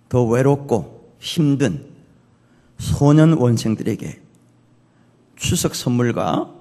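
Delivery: slow, deliberate reading with spaced phrases; message read aloud. Acted. Reads out a text message.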